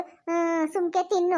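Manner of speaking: ordinary talk, nothing marked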